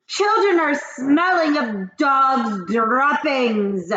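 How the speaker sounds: loud, very slow screech; witch screech. Acted. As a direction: drawl it out disgusted. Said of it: "screeched the Grand High Witch".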